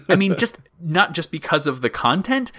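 usual speaking voice

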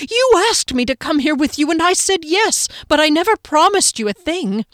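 high and loud indignant shout; angry shouting. A narrator's reading. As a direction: hammer every word. Kier keeps screaming at Golgothar.

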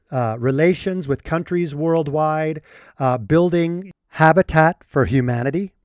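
usual speaking voice

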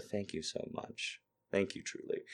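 breathy whispered thank you; soft sincere thanks. Real, not acted. Host gently thanking a nervous new viewer, whispered.

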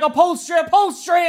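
high, loud repeated yelp; retold panicky shouting; frantic protest. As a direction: cry out in alarm for your car seats. Retells protesting about car upholstery.

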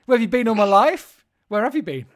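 loud hammed-up pining; mock lover's lament. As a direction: wail it like a jilted lover.

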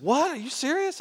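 put-on young man offered a car, high surprised question; incredulous. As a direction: pop up in shock at the gift. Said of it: "He said".

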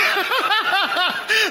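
short burst of high, loud laughter. Real, not acted. Preacher laughing about the unclothed prophet, high laugh. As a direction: crack up at your own joke. Laughs at his own joke.

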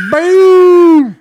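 loud high mock explosion; explosion boom. Recapping the alien movie to his cohost, a loud explosion boom.

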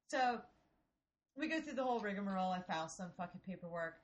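ordinary talk

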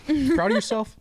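imitated heckler, very high and loud; heckler's challenge. Heckler repeats himself to the comic, challenging. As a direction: pitch the taunting question way up, loudly.

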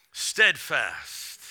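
ordinary delivery

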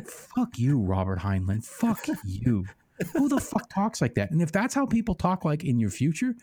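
comic outrage, voice climbing high; exasperated rant. Real, not acted. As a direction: rail at the author in disbelief. Mock rant at the author.

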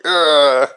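loud mock groan of frustration; exasperated groan. Retelling her reaction to the apology, exasperated groan. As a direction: groan it out, half laughing at yourself. Reacting to being told she's right.

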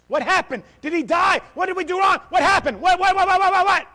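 frantic loud high-pitched questions; panicked shouting. Retold or imitated. Father finds wife crying on the floor, panicked shout. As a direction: shout it like you fear the worst. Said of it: "I'm out of my wits".

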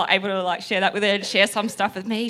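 ordinary delivery

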